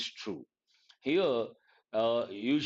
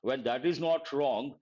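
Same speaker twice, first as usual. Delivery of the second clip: ordinary delivery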